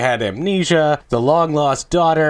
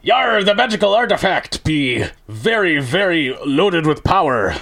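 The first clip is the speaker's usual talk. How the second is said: put-on pirate accent; 4 semitones higher than usual. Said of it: "in a pirate accent".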